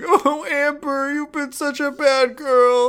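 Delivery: high, slow, whimpering "Oh, Amber"; hammed-up weepy lament; mock weeping Josh. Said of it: "He's just sitting there weeping".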